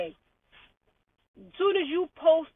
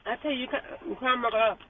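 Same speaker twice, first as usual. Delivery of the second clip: normal talking voice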